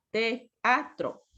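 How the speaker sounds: "teatro" as te, a, tro; split into syllables. Shown as a demonstration. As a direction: separate the E and A in "teatro". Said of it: "Yo separo estas dos".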